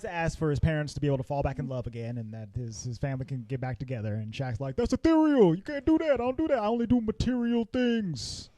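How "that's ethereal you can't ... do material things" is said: high-pitched Shaq put-on voice; acted refusal. Shaq turning down Max's wish about his parents, in a high put-on refusal.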